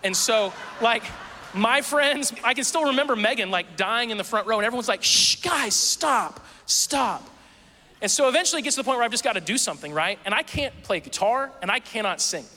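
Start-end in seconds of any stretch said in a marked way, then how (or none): 5.0-7.3 high, hushed scolding shh; shushing. Retold or imitated. Church crowd hushing laughers, a mock scold. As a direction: urgently hush the laughing friends.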